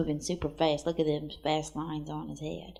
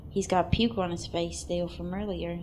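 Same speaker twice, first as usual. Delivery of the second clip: ordinary talk, nothing marked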